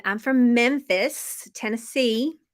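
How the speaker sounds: slow, drawn-out Southern accent; thick Memphis Southern accent. Shown as a demonstration. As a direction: stretch it out in a thick Southern twang. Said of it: "use my Southern accent".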